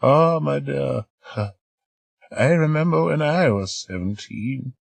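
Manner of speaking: put-on high, slow, sighing old voice; nostalgic old lady. Old lady remembering her youth, slow nostalgic sigh. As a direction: drift into memories. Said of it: "A nostalgic sigh".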